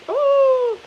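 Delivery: high, loud mock "Oh" yelp; son's high yelp. Mother imitating how her son used to yelp, high and loud. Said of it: "My son used to talk like".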